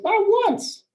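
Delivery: very high-pitched mock-incredulous reply; emphatic denial. [name] reassuring a nervous colleague, emphatically high. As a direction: squeak it out as an airy dismissal.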